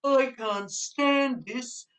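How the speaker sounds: slow, drawn-out exasperated bear; fed-up Mister Bear. Acted. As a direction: drag it out, sick of the noise. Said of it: "said Mister Bear".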